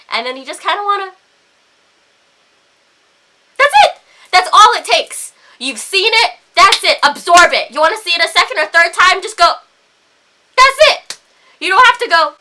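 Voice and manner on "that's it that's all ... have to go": loud, high, insistent telling-off; exasperated scolding. Real, not acted. Woman lecturing men on glancing without staring, exasperated. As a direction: punch out each short phrase in frustration.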